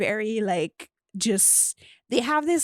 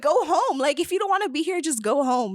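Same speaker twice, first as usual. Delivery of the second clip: high, insistent retold outburst; exasperated dismissal; 6 semitones higher than usual. Retelling how she sent her date home, exasperated. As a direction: send the pushy date away, pitched well up.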